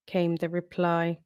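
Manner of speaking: usual speaking voice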